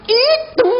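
sudden very high squawk; mock motherly screech; scolding mother. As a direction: squeal it like an exasperated mum. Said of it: "Mak kata".

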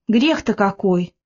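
usual speaking voice

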